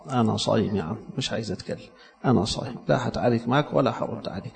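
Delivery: soft, steady "I'm fasting"; calm refusal. Retold or imitated. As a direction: decline the fight gently and quietly. Calm reply to an insult.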